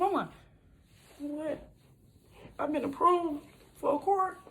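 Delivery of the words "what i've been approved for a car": high, slow astonished question; stunned she got it; disbelief. Retold or imitated. Hearing the approval, high slow disbelief.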